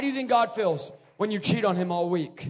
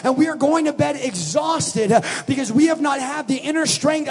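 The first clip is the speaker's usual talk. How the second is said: louder preaching that climbs as it goes; building sermon; 3 semitones higher, 5 dB louder than usual. Acted. Preacher warning the church about busy lives, building. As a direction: push the congregation, building.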